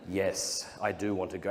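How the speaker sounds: ordinary talk, nothing marked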